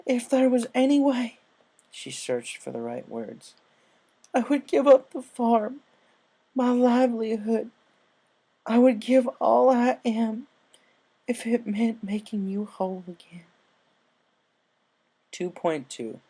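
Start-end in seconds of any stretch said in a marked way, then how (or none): 0.1-1.4 put-on high, slow, earnest young pony vow; pleading filly voice. 4.3-13.5 pleading filly voice. Acted. Character's pleading vow.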